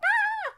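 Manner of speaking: short high mock shriek of panic; panicky shriek. The loud panicky inner voice, a quick high shriek. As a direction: yelp like the panicking voice. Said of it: "It's not the loud one".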